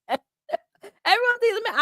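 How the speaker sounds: high, slow, through real laughter; laughing protest. Real, not acted. Streamer answering viewers who think she's angry, laughing. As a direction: protest while cracking up.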